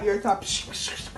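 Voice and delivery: usual speaking voice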